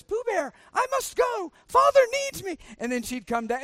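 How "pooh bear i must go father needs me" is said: put-on much higher, theatrical child voice; a little girl nobly bidding her toy farewell; high theatrical child leaving her toys; child's toy play. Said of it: "she'd say to her toys".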